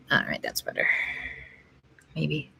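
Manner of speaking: normal talking voice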